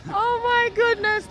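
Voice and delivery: high, drawn-out amazed exclamation; delighted amazement. Real, not acted. Thanking the young man for his patois, amazed. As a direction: gush it high and slow, real delight.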